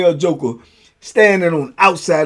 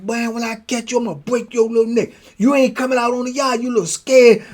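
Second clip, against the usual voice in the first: higher mock threat through the door; 7 semitones higher than usual. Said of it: "be behind that junk like".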